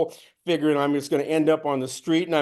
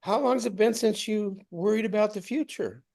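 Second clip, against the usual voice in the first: softer, higher gentle question; soft voice of insight; 6 semitones higher, 5 dB quieter than usual. Retold or imitated. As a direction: ask it tenderly. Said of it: "It says".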